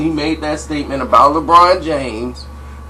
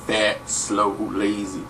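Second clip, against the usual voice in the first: muttered insults; 7 semitones lower, 8 dB quieter, slower than usual. Retold or imitated. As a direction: lower and much softer insults. Quoting Pippen's insults.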